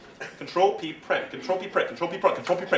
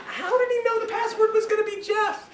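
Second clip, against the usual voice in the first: much higher squeaky disbelief; incredulous viewer. Retold or imitated. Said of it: "we're home going".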